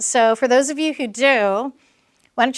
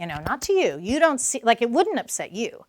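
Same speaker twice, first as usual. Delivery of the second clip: ordinary talk, nothing marked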